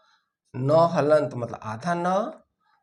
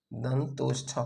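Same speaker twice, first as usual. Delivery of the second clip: soft echo; 4 semitones lower, 7 dB quieter, slower than usual. Shown as a demonstration. Teacher echoes the answer softly.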